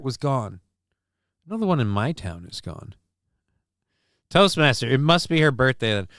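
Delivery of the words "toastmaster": ordinary talk, nothing marked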